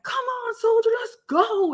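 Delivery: high-pitched mock sergeant's rallying call; urging drill sergeant.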